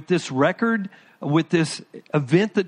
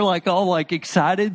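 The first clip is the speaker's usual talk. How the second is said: ordinary talk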